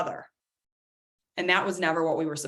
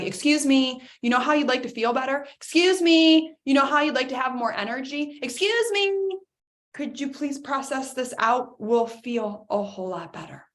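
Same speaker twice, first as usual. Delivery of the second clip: voice of the body; 6 semitones higher than usual. Pretend. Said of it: "body's tapping you on the shoulder, being like".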